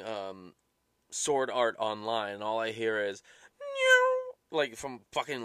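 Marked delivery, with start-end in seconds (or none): 3.6-4.6 high, loud eerie swoop; spooky high "nyew" noise; ghost sound. Retold or imitated. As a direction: imitate an anime ghost whoosh. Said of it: "like, ghost sound or whatever".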